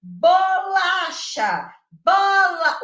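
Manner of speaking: high, loud, slow "Bolacha", then loud "Bola"; teacher's syllable demo. Shown as a demonstration. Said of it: "do professor fazer assim".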